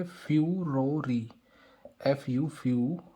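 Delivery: फ्यूरोरी in parts, fyu, ro, ree; trick pronunciation. Shown as a demonstration. Teacher showing how to read the word, फ्यूरोरी split into syllables. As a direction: end फ्यूरोरी on a clear long "ee". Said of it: "इसको हम पढ़ेंगे".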